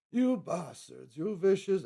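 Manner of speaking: high, loud, slowed mock-British outburst; bad British accent. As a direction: ham up a British cook's furious rant. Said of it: "that was actually pretty bad".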